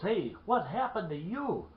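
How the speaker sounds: acted-out granddad demanding what happened; concerned questioning. Granddad alarmed at bleeding man.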